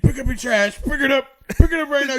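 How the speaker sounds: high, loud barking order; mascot yelling. Retold or imitated. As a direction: yell it at the kid. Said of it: "He like goes up to a kid".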